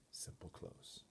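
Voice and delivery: plain reading voice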